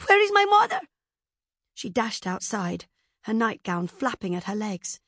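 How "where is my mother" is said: put-on high, loud little-girl voice; child's panicked cry. A narrator's reading. Child struggling from her bed, crying out in panic. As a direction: cry out, desperate for mother. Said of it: "cried the child, struggling from her bed".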